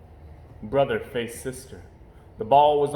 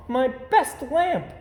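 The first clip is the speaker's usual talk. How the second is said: dismayed; 12 semitones higher, 4 dB louder, slower than usual. Acted. Mama mourns broken lamp.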